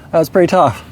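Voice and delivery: ordinary delivery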